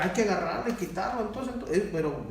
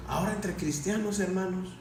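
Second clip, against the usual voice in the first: ordinary talk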